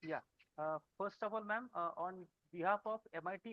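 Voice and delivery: very faint, far from the mic; barely audible. Real, not acted. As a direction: voice too low to hear. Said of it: "but your voice is very low".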